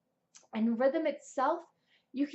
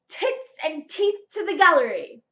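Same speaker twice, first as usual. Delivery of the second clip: loud, higher, theatrical; projected to the gallery; 4 semitones higher, 9 dB louder than usual. Retold or imitated. Quoting a singer's tip, loud theatrical projection. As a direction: throw it to the back row. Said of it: "a singer from Yorkshire and gave this tip".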